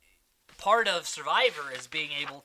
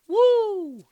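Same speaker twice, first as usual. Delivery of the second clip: high, loud mock cheer; celebratory whoop; 12 dB louder than usual. Host cheers for the castaway's idol, high loud whoop. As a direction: whoop like a winner.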